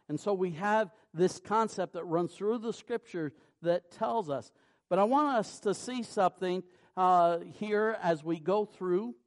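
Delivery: rising preacher's fervour; preaching. Acted. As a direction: drive the point home from the pulpit. Preacher builds to full force.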